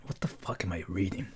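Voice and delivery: breathy whisper. Real, not acted. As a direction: whisper it in shock. Repeats line.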